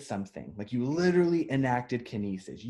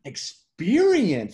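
high, slow, stunned amazement; awestruck; 8 semitones higher, 6 dB louder than usual. Retold or imitated. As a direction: gasp it out, blown away. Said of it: "They're like".

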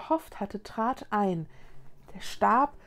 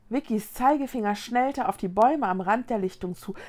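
ordinary delivery

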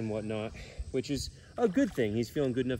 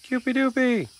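very high, loud cooing nickname; squeaky pet nickname; 13 semitones higher, 8 dB louder than usual. Real, not acted. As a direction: dote on the pet. Baby talk to pet.

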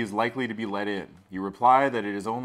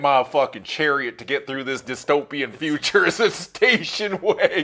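loud, higher mock outrage at the station wagon; mock indignation; 5 semitones higher, 7 dB louder than usual. Player ridiculing the stranger's car, loud mock outrage. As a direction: scoff loudly at the idea of a station wagon.

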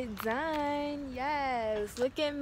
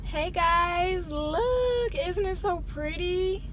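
excited; 8 semitones higher, 3 dB louder, slower than usual. Real, not acted. Showing off her new hair.

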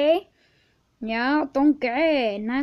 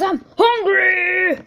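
high, loud, stretched-out shout; hungry holler; 5 semitones higher, 6 dB louder, much slower than usual. Real, not acted. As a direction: bellow your hunger for comic effect. Comic outburst about hunger.

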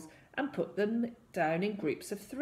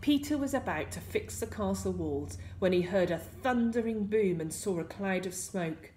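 ordinary delivery

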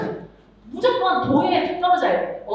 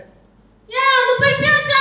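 much higher, loud bark of an order; high scolding shout; 12 semitones higher, 9 dB louder than usual. Shown as a demonstration. Teacher demoing a high, loud order to kids. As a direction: yell at the kids in a high sharp voice. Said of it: "이거 높죠?".

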